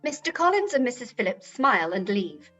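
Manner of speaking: ordinary talk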